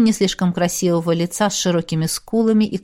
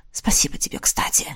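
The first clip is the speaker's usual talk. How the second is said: whispered; 4 semitones lower, 5 dB quieter than usual. A narrator's reading. Quiet thanks to spirit.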